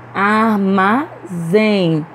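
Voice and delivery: "Armazém" very slow, in three syllables, strong zém; stress on last syllable. Shown as a demonstration. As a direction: stretch out "Armazém", leaning on zém. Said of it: "Pronunciamos com mais força a última sílaba". ("Armazém" bem devagar, silabado, com força em zém)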